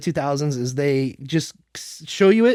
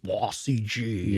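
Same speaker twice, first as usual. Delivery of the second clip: bored mock drone; 7 semitones lower, 8 dB quieter, much slower than usual. Mocks long CGI shots.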